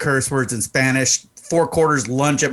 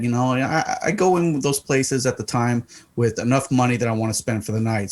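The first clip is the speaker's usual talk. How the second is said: ordinary delivery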